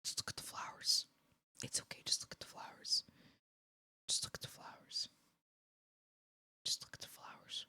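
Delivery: hushed, unvoiced coaxing; soothing whisper. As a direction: whisper it softly as if calming a victim. Whispers as the executioner.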